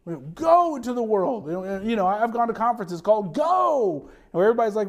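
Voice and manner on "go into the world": "go" long and strongly stressed. Shown as a demonstration. Preacher showing the common misreading, heavy stress on "go". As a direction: lean hard on the word "go". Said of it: "put the emphasis on go".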